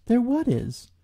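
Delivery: high, squeaky question; puzzled Piglet. Acted. Piglet puzzled by Eeyore, high and squeaky. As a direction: ask it small and high.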